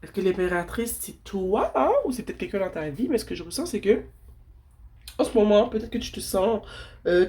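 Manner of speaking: normal talking voice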